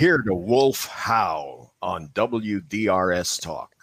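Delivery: radio announcer plug. Shown as a demonstration. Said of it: "one more time".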